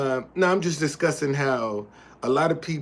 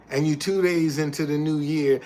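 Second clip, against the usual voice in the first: normal talking voice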